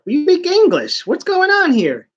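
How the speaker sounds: high, incredulous hero voice; hammed-up startled hero; mock surprised hero. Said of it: "He's like".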